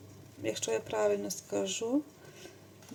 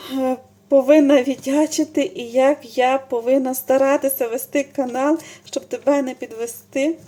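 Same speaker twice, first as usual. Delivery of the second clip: emotional thanks; 3 semitones higher, 9 dB louder than usual. Real, not acted. Vlogger thanking friend Lilia for the gift, gushing. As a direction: pour out heartfelt thanks.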